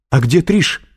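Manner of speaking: high, loud demand; exasperated, alarmed outburst; losing patience. A narrator's reading. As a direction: burst out with the question, frantic. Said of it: "не выдержала Кэтрин".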